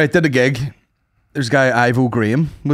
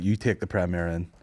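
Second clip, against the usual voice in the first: offhand suggestion; 4 semitones lower, 8 dB quieter than usual. Retold or imitated. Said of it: "so I was like".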